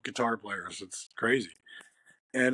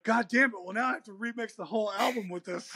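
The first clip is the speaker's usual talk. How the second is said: higher, half-laughing mock exasperation; Chad's mock frustration; 8 semitones higher, faster than usual. Guitarist quoting Chad's reaction to the new mix, higher with laughing mock exasperation. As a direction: play up the bandmate's fake outrage, laughing through it. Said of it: "He's like".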